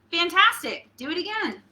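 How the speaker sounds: loud, high, eager praise; the princess shouts up at the tired dragon; shouted. Acted. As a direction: cheer the dragon on to go again. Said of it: "but Elizabeth shouted".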